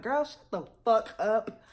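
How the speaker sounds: drawn out, talking down to her; a lazy girl-please brush-off; slow dismissive put-down. Retold or imitated. Sailor brushing off her shipmate, slow and dismissive.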